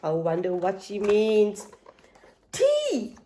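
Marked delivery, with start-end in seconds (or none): 2.5-3.2 sudden high startled yelp; a high frightened squeak; startled cry. Acted. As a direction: jump in fright on it.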